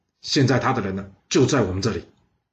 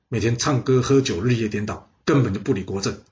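ordinary delivery

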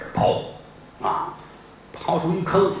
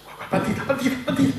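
urgent orders; 10 semitones higher, much faster than usual. Acted. Boss urgently orders the ladder.

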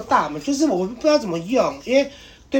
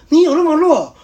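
mocking sister; 10 semitones higher, 11 dB louder than usual. Sister mocks him on phone.

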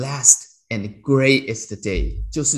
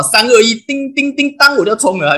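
race countdown; 11 semitones higher, 8 dB louder than usual. Retold or imitated. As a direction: count down like an F1 start light. Imitating race start countdown.

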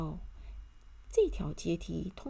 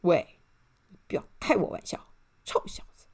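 irritated scolding; 4 dB louder than usual. A narrator's reading. Arrogant man scolds the elf.

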